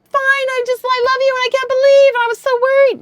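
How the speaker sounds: high, fast, syrupy sweet pretend wife; mock gushing relief. Wife mocking the relieved greeting her husband expected, gushing high and fast. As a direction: gush it out like the relieved wife she wasn't. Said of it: "And instead of being".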